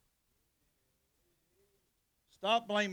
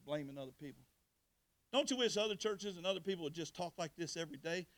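normal talking voice

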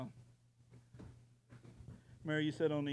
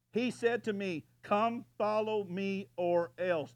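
booming command; 5 semitones higher, 7 dB louder, slower than usual. Acted. Preacher booms the Lord's command.

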